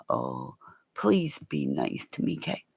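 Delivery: soft coaxing to the voice assistant; pleading softly. Retold or imitated. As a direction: beg the phone gently. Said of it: "this is when I say".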